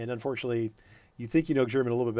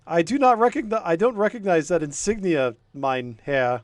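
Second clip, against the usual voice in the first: put-on loud, high German accented challenge; stern German guard; 6 semitones higher, 6 dB louder than usual. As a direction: bark it like a suspicious sentry.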